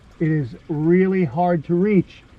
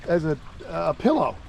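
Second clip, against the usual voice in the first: usual speaking voice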